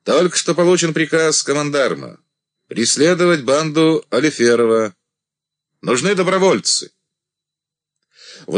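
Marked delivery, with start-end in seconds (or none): 0.0-6.9 loud, high address to troops; commanding. A narrator's reading. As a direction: call for volunteers to the ranks.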